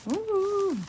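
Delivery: delighted ooh. Real, not acted. Delight at a new find.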